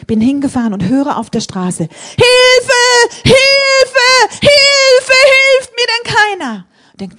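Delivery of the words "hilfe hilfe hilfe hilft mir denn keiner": put-on high, loud panicked screams of an old woman; desperate cries for help. Preacher voicing the dying grandmother's screams, panicked and shrill. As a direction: cry out for help in terror, pitch way up. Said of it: "schreit schon seit Stunden Hilfe".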